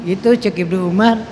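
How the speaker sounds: normal talking voice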